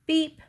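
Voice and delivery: high, short beep; truck beep. A narrator's reading. Blue offers his friends a ride, high beep. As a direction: toot it like a small truck. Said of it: "said Blue".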